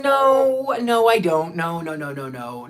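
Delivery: hammed-up refusal that loses steam; mock protest. Buyer resisting the friend's urging, mock-protesting loudly then fading. Acted refusal to buy puppets.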